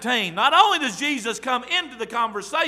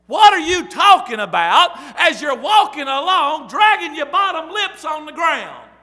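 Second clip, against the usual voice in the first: incredulous shout; 3 semitones higher, 7 dB louder than usual. Retold or imitated. Preacher as Jesus, shouting his question at sulking disciples. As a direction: boom the question at the sad pair.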